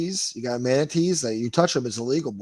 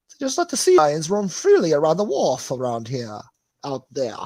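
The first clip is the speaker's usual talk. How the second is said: mocking; 4 semitones higher, 6 dB louder than usual. Shown as a demonstration.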